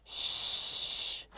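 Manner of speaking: breathy hushing sound; shushing. A narrator's reading. The other children shushing Kiko, a hiss. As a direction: hush the class sharply. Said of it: "the other children said".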